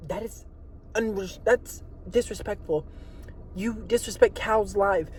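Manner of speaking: mock offended critic. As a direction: protest quietly in pretend hurt. Imagined offended critic answers back.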